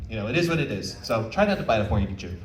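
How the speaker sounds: normal talking voice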